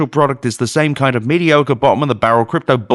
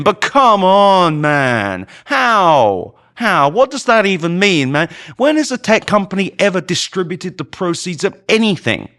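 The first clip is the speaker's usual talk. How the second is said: exasperated; 7 semitones higher than usual. Real, not acted. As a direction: protest in a higher, incredulous voice. Pleading exasperation with the journalist's claim.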